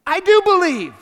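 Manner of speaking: shrieked high and loud like a crow; crow-like blurt. Acted. Desperate father blurting to Jesus like a crow, shrieked. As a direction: blurt it out like a cawing bird. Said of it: "Just like a crow".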